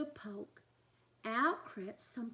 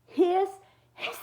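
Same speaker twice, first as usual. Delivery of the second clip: hissing; 7 semitones higher, 11 dB louder than usual. A narrator's reading. Monsters learn to hiss.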